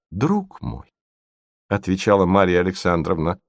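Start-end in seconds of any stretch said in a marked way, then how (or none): 0.1-0.9 high, soft, tender address; painfully gentle. A narrator's reading. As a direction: soothe him with a tender murmur. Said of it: "был болезненно мягкий".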